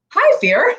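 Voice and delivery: high-pitched, drawn-out hello to fear; greeting fear. Shown as a demonstration. Coach shows how to greet the fear in your lap, high-pitched greeting. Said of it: "you're gonna go".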